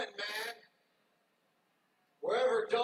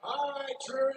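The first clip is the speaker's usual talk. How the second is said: normal talking voice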